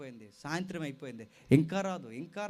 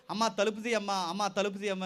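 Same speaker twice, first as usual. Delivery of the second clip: calling through the door; 4 semitones higher, 7 dB louder than usual. Acted. Parents calling at locked door.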